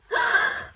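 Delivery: a high, sharp gasp of disbelief; real thrilled gasp; surprised gasp. Real, not acted. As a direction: gasp as she reads the title. Discovers which book she got.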